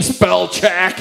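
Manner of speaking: high mocking taunt. Mocking the speller in a high taunting voice. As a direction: tease in a high voice.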